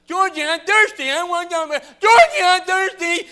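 high, very loud put-on nagging; real loud. Pretend brother nagging George down the aisle, real loud. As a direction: keep hollering it high, hammed-up. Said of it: "real loud".

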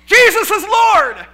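loud, slow, high proclamation; preaching to a crowd in the street; preacher calling out. Retold or imitated. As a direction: call it out to the square.

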